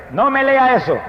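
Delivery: imitated cry of refusal; protesting wife. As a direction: voice the wife's sharp protest in a retelling. Wife's objection acted out in story.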